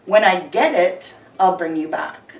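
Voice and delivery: ordinary talk, nothing marked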